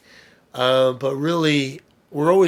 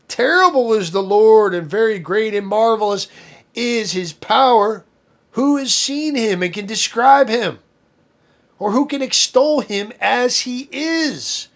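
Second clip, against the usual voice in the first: raised, solemn reading of scripture, slowed in places; 6 semitones higher, 4 dB louder than usual. Acted. Preacher reading scripture to the congregation, proclaiming with awe.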